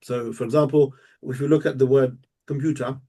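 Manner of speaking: normal talking voice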